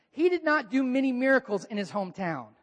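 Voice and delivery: usual speaking voice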